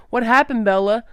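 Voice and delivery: father shouting through the door. A narrator's reading. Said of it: "Charlie shouted through the door".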